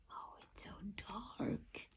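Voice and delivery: soft frightened whisper; scared whisper. Acted. Jasper alone in the dark bedroom, scared whisper. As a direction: breathe it afraid of the dark.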